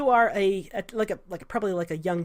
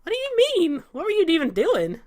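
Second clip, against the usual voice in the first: baffled high question; 10 semitones higher than usual. Acted. Salvador baffled.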